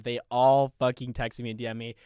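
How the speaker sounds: ordinary talk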